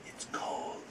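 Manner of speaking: breathy whispered ghost voice, very soft; a ghost whispering from the wall; ghostly whisper. Retold or imitated. Ghost voice in told story.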